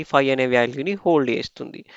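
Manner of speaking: usual speaking voice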